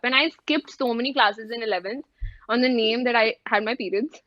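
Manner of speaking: normal talking voice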